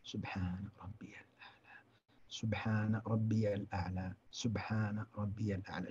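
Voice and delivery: slow, whispered, each phrase separated; unhurried, soft, pausing prayer; slow hushed dhikr with pauses. Shown as a demonstration. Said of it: "شوي شوي".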